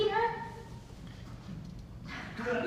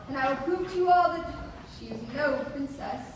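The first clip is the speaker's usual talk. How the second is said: ordinary talk, nothing marked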